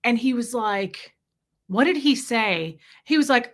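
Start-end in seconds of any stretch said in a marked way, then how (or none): none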